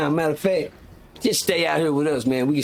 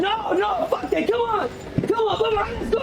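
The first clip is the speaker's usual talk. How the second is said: very high hyped rallying cry; fired up. Retold or imitated. Inmates rallying each other for a brawl, very high hyped cry. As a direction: call the crew into the fight.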